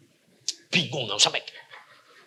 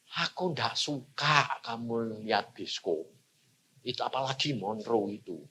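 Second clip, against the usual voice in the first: a calm inner voice speaking gently; voice of God; 9 semitones lower, 14 dB quieter than usual. Acted. God quietly tells the preacher he dislikes the film, hushed and calm.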